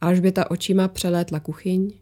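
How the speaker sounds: ordinary delivery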